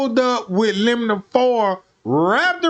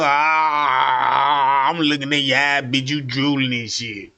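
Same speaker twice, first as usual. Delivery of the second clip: gremlin voice; 7 semitones lower, slower than usual. Retold or imitated. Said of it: "he sound like a gremlin".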